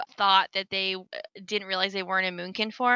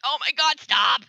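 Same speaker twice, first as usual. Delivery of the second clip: raised, louder exasperated plea; mock exasperated outburst; 6 semitones higher, 4 dB louder than usual. Player to raid leader, mock exasperated outburst. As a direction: act out fed-up protest, punching the last word. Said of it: "makes me wanna scream".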